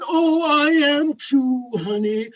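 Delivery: long drawn-out mooing apology; very slow, droning away into the distance; trailing off like a cow. Retold or imitated. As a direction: stretch the vowels into a mournful moo. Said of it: "her voice just trailed off".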